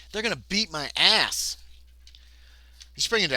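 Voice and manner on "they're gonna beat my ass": slow, higher, louder complaint; exasperated, doomed exclamation; dismayed outburst. Real, not acted. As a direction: punch out each word in dismay. Dismay at hopeless fight.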